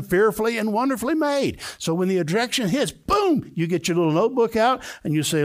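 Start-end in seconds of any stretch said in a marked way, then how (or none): 2.9-3.5 sudden boom. Acted. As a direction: hit it like an impact. The moment rejection hits.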